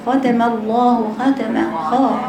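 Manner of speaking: slow ختم with a weak خ; wrong kha. Shown as a demonstration. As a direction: imitate a kha not from the throat. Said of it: "لا، هاتيها من حلقك". (خاء غلط)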